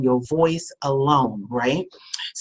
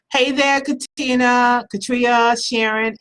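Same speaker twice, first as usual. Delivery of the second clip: singsong greeting; 6 semitones higher, 3 dB louder than usual. Real, not acted. Greets viewers joining the live.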